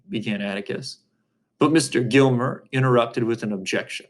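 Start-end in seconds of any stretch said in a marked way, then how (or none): none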